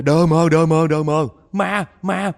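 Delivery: high, loud terrified yelling; frantic repeated shrieks of terror; panicked scream. Acted. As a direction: scream in panic that you saw a ghost. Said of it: "Nó la lên á".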